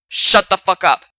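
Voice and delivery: loud furious shout; angry shout. Acted. Frank yelling at Alex to shut up, furious shout. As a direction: bark it right in his face. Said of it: "he said".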